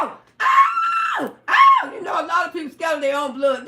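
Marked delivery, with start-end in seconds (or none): 0.0-1.9 high shrill-free yelps, way higher and louder; mock pained yelp. [name] imitating the cut woman yelling ow. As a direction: mimic her crying out in pain. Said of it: "She just seen blood running from her".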